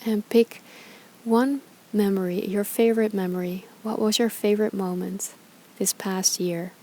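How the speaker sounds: calm, unhurried meditation guide with long pauses; guided meditation. Acted. Yoga teacher guiding followers through gratitude reflection, calm meditation voice. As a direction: ease listeners through each breath, leaving space between phrases.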